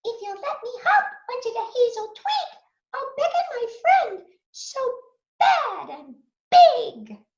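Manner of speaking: very high, fast, squeaky mouse voice; a clever little mouse talking fast; high mouse slowing on bad and big. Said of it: "said the mouse".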